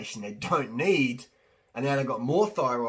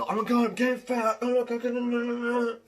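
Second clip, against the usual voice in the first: high, frantic mock panic over getting fat; panicked woman; 10 semitones higher, 3 dB louder than usual. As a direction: squeal it like a woman horrified at her weight. Mocking a panicked woman on weight.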